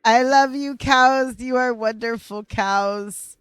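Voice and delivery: high, loud declaration of love; loving cry. Real, not acted. Streamer calling to the cows, high and loud with love. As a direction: call out fondly to the cows.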